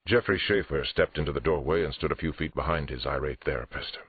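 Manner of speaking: plain reading voice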